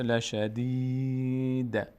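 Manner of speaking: slow, drawn-out chanted Arabic recitation; slow Quran recitation. Shown as a demonstration. Teacher slowly reciting the verse for the student, chanted. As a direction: stretch every syllable as in tajweed. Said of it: "teacher recites verse ending".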